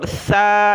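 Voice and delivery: سا with tongue tip out, like English "th"; lisped, whistle-free th on سا; tongue-tip tha. Shown as a demonstration. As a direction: say سا as "thaa". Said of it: "زبان کی نوک باہر نکالی جاتی ہے".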